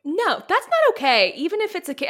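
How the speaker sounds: indignant. Retold or imitated. Imitating telling off a child who smacked a woman, indignant. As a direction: scold it firmly. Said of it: "And I'm like".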